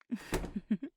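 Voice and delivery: a small amused chuckle; short chuckle. Acted. Woman listening fondly to her partner, short chuckle.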